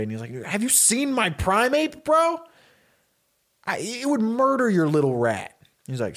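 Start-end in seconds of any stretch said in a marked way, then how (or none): 0.4-5.5 high, incredulous bragging; annoyed teenager. Acted. Teenager dismisses a challenger's little rat, annoyed and incredulous. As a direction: scoff in a raised, acted teen voice. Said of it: "Ryan looks kind of annoyed".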